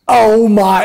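loud, high drawn reaction of shock; acted high, loud dismay; shocked niece. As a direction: imitate a startled niece crying out. Acted niece seeing armed buddies.

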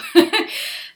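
high laugh at own pun. Real, not acted. Teacher laughs at own rainbow pun, bright laugh. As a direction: cackle at the cheesy joke. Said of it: "get it? ... one cheesy joke".

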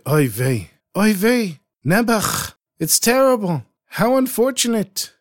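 put-on high, lamenting Yiddish-accented old man. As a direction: groan it out in a Yiddish accent, full of pity. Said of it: "and he said".

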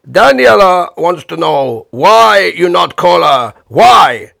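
put-on slow, loud Italian accent, each why pushed harder; demanding Italian host. Host badgering Bob about Daniela, loud Italian-accented demands. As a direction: grill him in an Italian accent. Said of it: "Like that".

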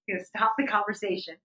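usual speaking voice

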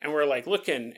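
ordinary delivery

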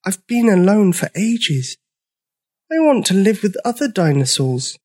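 high-pitched, pleading little voice; plaintive tiny dinosaur voice; begging. A narrator's reading. Lonely dinosaur asking to stay, begging. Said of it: "begged Sizo".

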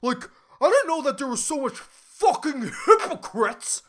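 mock-angry, hammering out "hypocrites" syllable by syllable; loud indignant rant, over-pronouncing "hypocrites"; over-enunciated HYPOCRITES. Imitated student complaining to mom, "hypocrites" hugely over-enunciated. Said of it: "she was over-enunciating, she was over-pronouncing the word hypocrite".

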